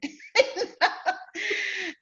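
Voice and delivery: high-pitched burst of laughter. Real, not acted. Author laughing about her angry first draft, high real laugh.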